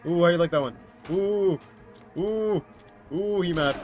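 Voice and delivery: high, loud teasing; mocking ooh. Real, not acted. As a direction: tease him loudly. Taunting the angry monster.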